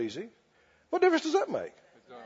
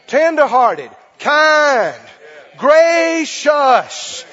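loud, rising sermon cry; fiery preaching; 5 semitones higher, 8 dB louder than usual. Acted. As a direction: lift the congregation with every word. Preaching at full force.

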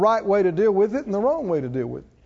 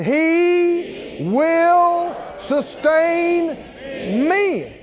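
proclaimed with faith; 7 semitones higher, much slower than usual. Shown as a demonstration. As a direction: cry it out like a victory. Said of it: "Say it again".

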